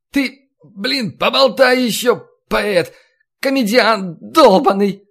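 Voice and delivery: high, cracking, hoarse shout; hoarse falsetto yell. A narrator's reading. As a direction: scream it, voice cracking up. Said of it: "Срываясь на сиплый фальцет, закричал Василий".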